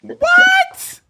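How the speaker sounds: very high, loud "what"; shocked shriek. Real, not acted. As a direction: shriek it in laughing disbelief. Host shocked by caller's accent claim.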